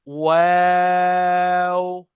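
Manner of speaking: वाव drawn out very long, lips rounded then spread; rounded-lip letter. Shown as a demonstration. Teacher modelling the rounded-lip letter, slow. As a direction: hold the letter out slowly. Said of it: "होंठ गोल करिए".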